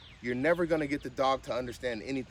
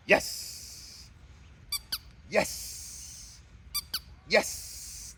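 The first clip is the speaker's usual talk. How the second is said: excited whispered praise; 10 semitones higher, much slower than usual. Real, not acted. Praising dog in training.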